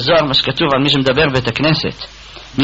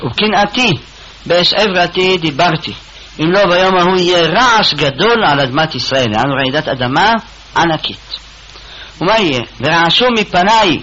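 preaching recital; 4 semitones higher than usual. Acted. Reciting Ezekiel verse, raised.

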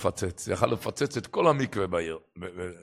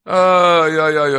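loud, high drawn-out "ay ay ay"; lamenting; 5 semitones higher, 13 dB louder, slower than usual. Acted. Storyteller voicing a poor man's worry before Shabbat, lamenting. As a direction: wail a long storyteller's lament, starting loud.

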